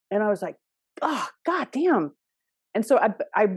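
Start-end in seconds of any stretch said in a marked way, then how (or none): 1.0-2.1 drawn-out, higher cry of dismay; stretched-out mock dismay; dismayed exclamation. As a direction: act out a slow, exasperated curse. Acted dismayed reaction in dream retelling.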